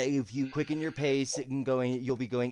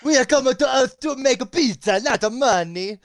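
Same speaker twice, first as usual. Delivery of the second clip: higher, louder mock Russian accent; 10 semitones higher, 7 dB louder than usual. Game master joining the joke, higher mock Russian accent.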